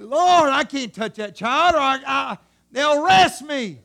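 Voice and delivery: loud, exasperated plea to the Lord; aggravated parent. Acted. Parent afraid of arrest for touching the child, aggravated. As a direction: cry out at your wits' end. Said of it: "So aggravated, so mad at him".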